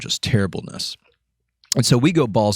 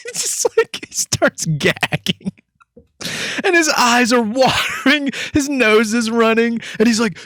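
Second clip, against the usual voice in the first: high squeaky laughing narration; barely talking through giggles; 10 semitones higher than usual. Real, not acted. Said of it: "I'm crying right now".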